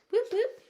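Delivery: high, loud double whoop; quick celebratory high whoops; playful whoop whoop. Real, not acted. Small cheer after finishing a step.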